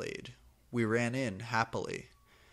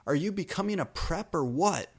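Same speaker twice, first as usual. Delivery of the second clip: higher, louder hammed-up accusation; shouting angrily; 7 semitones higher, 4 dB louder than usual. Goth girl accusing Draco of being a prep, mock angry shout. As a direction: accuse him like a sulky teen. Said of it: "I shooted angrily".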